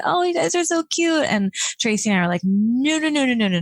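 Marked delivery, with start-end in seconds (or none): 0.0-1.2 high-pitched mock gushing; imitated cooing onlookers. Staff mistaking two friends for a couple, imitated gushing. 2.4-3.6 higher-pitched rattled-off protest; mock hasty denial. Two friends denying they are a couple, mock protest.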